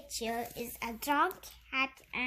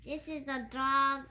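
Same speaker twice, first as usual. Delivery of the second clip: usual speaking voice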